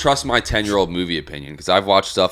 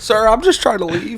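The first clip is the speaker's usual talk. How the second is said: mock pleading voice; 13 semitones higher, slower than usual. Voicing the scared guy caught.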